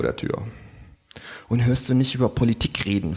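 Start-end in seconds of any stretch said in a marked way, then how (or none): none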